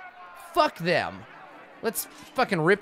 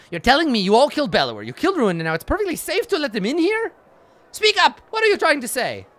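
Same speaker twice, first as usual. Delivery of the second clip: rising, louder, faster angry demand; angry challenge; 9 semitones higher, 4 dB louder, faster than usual. Acted. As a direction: push him hard, louder with each line. Confronting hero over letting Dredge in.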